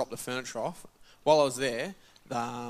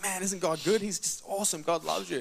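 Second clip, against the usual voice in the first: higher, bright rush of joy at the healing; excited; 5 semitones higher than usual. Retold or imitated. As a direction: gush over the good news, voice lifting. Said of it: "I was excited".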